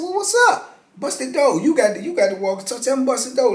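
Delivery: daring the guard. Retold or imitated. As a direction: dare him to bust the door. Daring the guard to open door.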